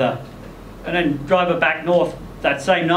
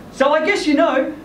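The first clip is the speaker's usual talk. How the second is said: Lynn's voice; 9 semitones higher than usual. Acted. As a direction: let her know he's found out. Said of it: "Then she says".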